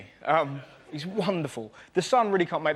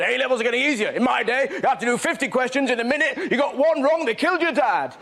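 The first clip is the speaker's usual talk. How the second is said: put-on grumpy old-timer; 5 semitones higher, 3 dB louder than usual. Grumpy elders mocked.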